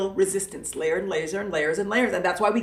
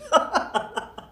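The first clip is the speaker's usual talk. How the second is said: high mock villain cackle; playful evil cackle; evil laugh; 5 semitones higher than usual. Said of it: "Evil laugh, you guys".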